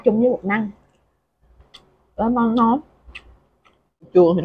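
talking while chewing food; speech muffled by food; mouth full, chewing. Real, not acted. Seen 4: Bites food at -2.0, chews through the start, then talks.

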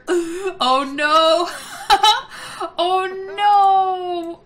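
loud, high wail through laughter; laughing dismay. Real, not acted. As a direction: cry it out while cracking up. Sim's accident makes her laugh.

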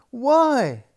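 put-on high, loud child's why; a fed-up little girl asking back; exasperated princess. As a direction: princess challenging the maid. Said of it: "said the little princess".

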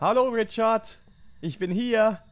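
loud playful German call. Pretend. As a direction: shout the German greeting teasingly. Calls out in German as joke.

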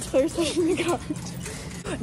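normal talking voice

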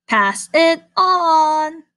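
very slow emphatic close; drawn-out ending. A narrator's reading. Reader ending the story, very slow emphatic. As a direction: stretch the final line out.

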